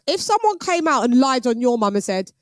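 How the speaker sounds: usual speaking voice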